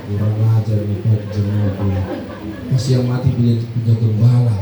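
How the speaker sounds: low, loud indignant grumble; a pastor fuming at the member; offended pastor, low and loud, ending in a laugh. Retold or imitated. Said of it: "Pendeta bilang".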